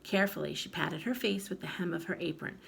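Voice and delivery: ordinary delivery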